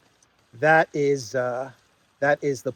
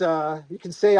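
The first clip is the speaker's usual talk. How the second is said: ordinary talk, nothing marked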